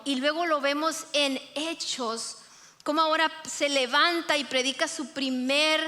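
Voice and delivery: ordinary talk, nothing marked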